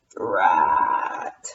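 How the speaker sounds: very low, slow grumble; low mummy groan. Acted. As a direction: growl it like a mummy.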